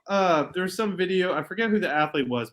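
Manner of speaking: ordinary talk, nothing marked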